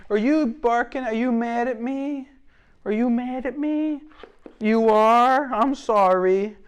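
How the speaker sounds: high-pitched doting voice for the dog. Real, not acted. Trainer soothing the barking dog, high sing-song baby talk.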